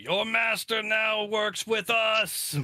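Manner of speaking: very high, loud, stretched-out shout; screamed proclamation. Acted. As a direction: bellow it slowly to the enemy troops. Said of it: "just scream out".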